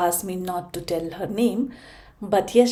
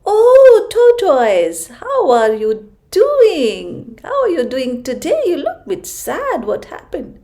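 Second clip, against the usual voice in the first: much higher, louder, slow kindly giraffe; caring giraffe; 8 semitones higher, 10 dB louder than usual. Acted. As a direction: call down kindly from high above. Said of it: "Giraffe looked down at him and said".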